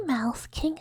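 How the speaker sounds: normal talking voice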